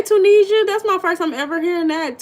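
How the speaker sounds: light up at a name you've never heard; delighted surprise. Real, not acted. New name read from chat.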